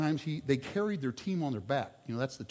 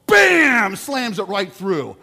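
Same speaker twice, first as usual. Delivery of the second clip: shouted "bam", much louder, then slow; 6 semitones higher, 13 dB louder, much slower than usual. Retold or imitated. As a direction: slam the words down like the dunk. Jordan dunks in story.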